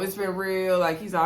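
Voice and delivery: usual speaking voice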